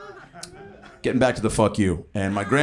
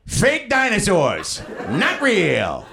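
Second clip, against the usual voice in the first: grandma's heckle; 8 semitones higher, much slower than usual. Retold or imitated. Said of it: "Which was followed by".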